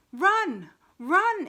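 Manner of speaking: very loud, high shout of warning; distant voice crying out, loud and high; urgent cry. A narrator's reading. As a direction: yell it so they flee. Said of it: "it urged once more".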